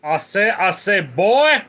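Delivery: big blustery southern rooster call; Foghorn Leghorn. Retold or imitated. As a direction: boom it out like the blustering cartoon rooster. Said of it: "perhaps a foghorn leghorn?".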